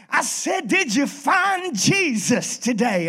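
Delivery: high, slow, pressing preacher voice; insistent preacher. Retold or imitated. Preacher in the river demanding Boudreaux's answer, high and insistent. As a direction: lean on him like a fiery preacher.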